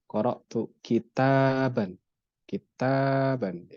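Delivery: kitaban slowly, the long ba held; long vowel. Shown as a demonstration. Teacher shows students the alif lengthening, slow. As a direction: stretch the ba in kitaban. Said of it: "dia jadinya panjang".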